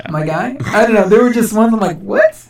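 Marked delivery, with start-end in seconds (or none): 2.0-2.4 high-pitched baffled "what"; incredulous retort. Retold or imitated. Podcaster reacting to silly would-you-rather questions, incredulous. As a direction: pitch shoots up in mock disbelief.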